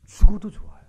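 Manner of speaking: loud, high-pitched declaration; emphatic proclamation. Acted. Believer declaring faith before death, loud proclamation.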